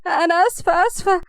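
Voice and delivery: flustered, high-pitched apology; nervous apology. A narrator's reading. Rahaf apologising to the scalded groom, nervous. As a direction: apologise in a high, anxious rush of concern. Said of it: "بصت له رهف بتوتر".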